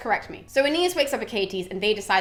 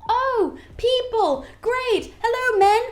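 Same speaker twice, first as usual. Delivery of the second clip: much higher, breezy put-on girl voice; a goddess faking innocence; hammed-up chirpy Spartan girl; disguised huntress; 9 semitones higher, 3 dB louder than usual. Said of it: "she turns around and she's just like".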